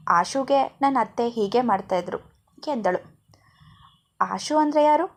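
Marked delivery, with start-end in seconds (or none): 4.2-5.1 high, puzzled ask; puzzled, rising question; confused question. A narrator's reading. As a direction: ask it baffled, pitch up. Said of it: "ಗೊಂದಲದಿಂದ ಕೇಳಿದ".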